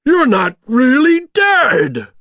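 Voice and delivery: high, loud, drawn-out disbelief; astonished monster yell; Oogie Boogie shouting. A narrator's reading. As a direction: cry out in villainous shock. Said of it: "shouted Oogie Boogie".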